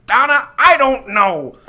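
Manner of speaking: exasperated yell, high and loud; dad yelling impression. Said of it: "you would hear him go".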